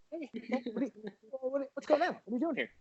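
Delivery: high, soft mock interrogator; hushed, high-pitched imitation; Chris from Dateline. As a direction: ask it small and high, like a host confronting a suspect. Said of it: "what's his name from Dateline?".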